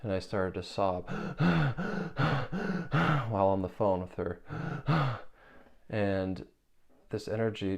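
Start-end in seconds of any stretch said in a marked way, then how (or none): 1.1-3.2 sharp high gasping breaths; reliving the sob with a gasp. Retold or imitated. Retelling sobbing breakthrough, gasps. 4.5-5.3 short high gasp; a quick sobbing gasp; gasping sob. Retold or imitated. Retelling sobbing, gasps.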